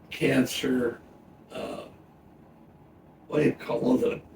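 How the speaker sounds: normal talking voice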